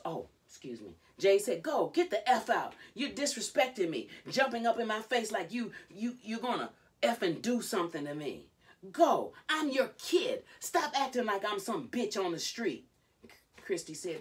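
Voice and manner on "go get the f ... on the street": high, loud re-enacted tirade; angry rant. Retelling Jade telling off her disrespectful mother, angry shouting. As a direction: shout it as a wounded, furious kid.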